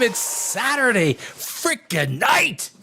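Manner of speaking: slow, stretched hype line; drawn-out hype. Real, not acted. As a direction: stretch every word like a ring announcer. Opening his live show.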